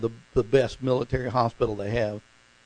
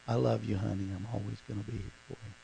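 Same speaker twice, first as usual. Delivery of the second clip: tender quote; 3 semitones lower, 10 dB quieter, much faster than usual. Retold or imitated. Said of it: "kisses that one little spot and says".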